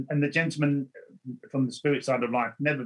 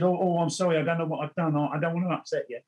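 higher, rushed, startled sorry; flustered apology; 5 semitones higher, faster than usual. Retold or imitated. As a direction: blurt it out, caught off guard by her tears. Stranger bursts into tears at his reading.

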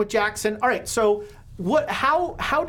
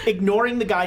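ordinary talk

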